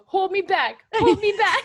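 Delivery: high, loud 'hold me back' bluster; mock held-back fighter. Retelling the wrestler's antics to co-hosts, mock bluster. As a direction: act out a scrappy fighter begging to be restrained. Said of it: "he literally was doing the".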